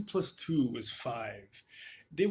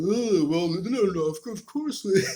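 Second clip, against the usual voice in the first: loud, higher, dopey know-it-all voice; mock Frank Turek; 5 semitones higher, 9 dB louder than usual. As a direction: ham up a smug apologist talking down. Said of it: "I'm sure he doesn't talk like that".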